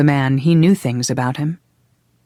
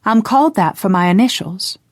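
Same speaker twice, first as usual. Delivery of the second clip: put-on higher and louder girl's voice; raised, higher young woman's voice; 6 semitones higher, 3 dB louder than usual. A narrator's reading. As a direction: speak up to be heard. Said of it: "she said louder".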